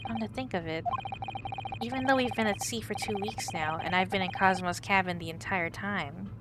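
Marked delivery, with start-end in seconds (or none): none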